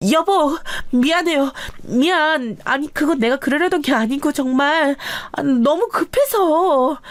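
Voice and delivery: high-pitched, drawn-out begging wife; cling and beg, voice pushed high; desperate pleading. A narrator's reading. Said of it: "바지가랑이를 잡고서 늘어지기 시작했습니다". (높은 목소리로 다급하게 매달리며 사과)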